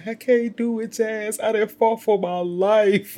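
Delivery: high, drawn-out helpless plea; mock helpless whine. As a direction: play the exhausted victim, hammed-up. Her whiny self in crisis.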